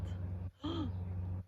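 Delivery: soft unvoiced gasp of surprise; mock gasp. Reader gasping to build suspense for the child. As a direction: suck in a startled breath.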